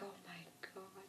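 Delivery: acted, barely voiced shock; stunned hush. Retelling his look at the growling walker, hushed mock shock.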